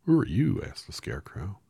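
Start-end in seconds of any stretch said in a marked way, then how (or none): 0.0-0.6 higher, louder curious straw man. Acted. Scarecrow asks Dorothy who she is, higher and louder. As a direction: pop the question up high.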